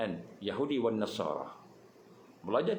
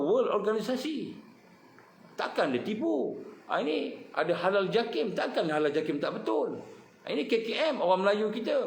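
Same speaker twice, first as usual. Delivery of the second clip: fiery preaching; 8 semitones higher than usual. Acted. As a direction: preach it hard at the crowd. Rising fiery sermon passage. (ceramah berapi-api, tinggi dan lantang)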